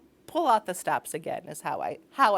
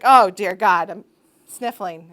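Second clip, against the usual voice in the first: a loud burst of hammed-up panic; mock panic about sniffles; 12 dB louder than usual. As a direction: play the hypochondriac in mock horror. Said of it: "now it's like".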